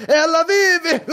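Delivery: shrill, high triumphant cry with a laugh; mad scientist triumph. Acted. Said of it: "Acabei me empolgando um pouco". (grito triunfante de cientista louco)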